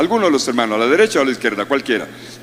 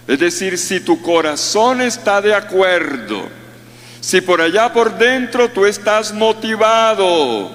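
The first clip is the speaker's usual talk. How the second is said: usual speaking voice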